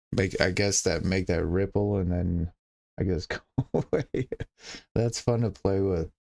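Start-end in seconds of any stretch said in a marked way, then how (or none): none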